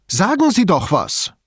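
high, insistent demand; drängend. A narrator's reading. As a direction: press for an answer. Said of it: "drängte sie weiter". (drängend, mit hoher Stimme)

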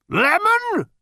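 one very high, loud shriek; furious scream. A narrator's reading. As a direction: scream it as an interrogation. Officer keeps bellowing drink questions.

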